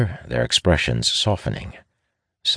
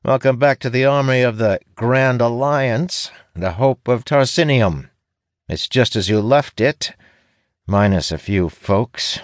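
high, grandly mocking welcome; sardonic; 9 semitones higher, 3 dB louder than usual. A narrator's reading. Apprentice welcoming a ranger back to the army, sardonic. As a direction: proclaim the titles with a sardonic grin. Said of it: "flashing a sardonic grin".

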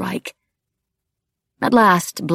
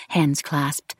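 usual speaking voice

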